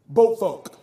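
ordinary talk

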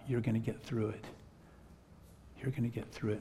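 close to her ear. Retold or imitated. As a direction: speak softly, as into a crying stranger's ear. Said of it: "face right down to her ear".

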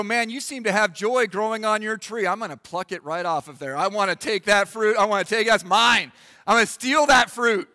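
a greedy churchgoer snatching someone's fruit, rising to a shout; mock greedy grab. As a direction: play the covetous grabber, building to a yell. Greedy churchgoer plucking others' fruit.

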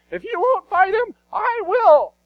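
David's brave high voice; best David voice. Acted. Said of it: "let me try to do my best David voice".

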